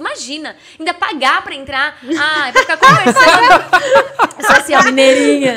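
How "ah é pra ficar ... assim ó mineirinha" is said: loud laughter breaking through the words; laughing. Real, not acted. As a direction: crack up at your own story. Laughing at own story. (rindo alto)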